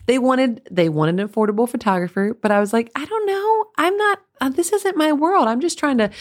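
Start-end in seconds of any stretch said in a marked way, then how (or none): 2.9-6.1 much higher, sheepish retold voice; flustered younger self. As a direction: play your clueless younger self, pitched way up. Retold nervous self at job.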